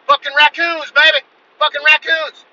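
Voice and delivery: high, loud holler back; shouted reply. Retold or imitated. Husband answering wife mid-shooting, high shouted reply. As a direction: yell it across the yard to the house.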